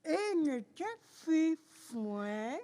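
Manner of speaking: talking around a pencil; pen in teeth. Real, not acted. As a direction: pencil clenched in mouth. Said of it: "avec un crayon dans la bouche".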